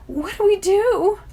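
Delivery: high slow scared question; frightened. A narrator's reading. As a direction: ask in a panic. Frightened under aimed arrow.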